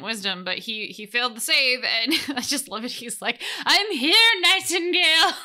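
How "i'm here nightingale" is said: first try at a hammed-up Austrian-ish accent, higher and louder; lightly voiced bodybuilder parody accent; rough mock Austrian voice; Hans and Franz voice. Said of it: "let me see if I can do that in a better Hans and Franz voice".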